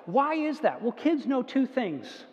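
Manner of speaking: usual speaking voice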